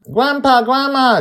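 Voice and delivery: put-on high excited kid calling out loud; child shouting. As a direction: yell like an eager little girl. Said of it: "Joy shouts".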